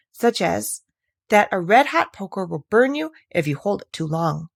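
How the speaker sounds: plain reading voice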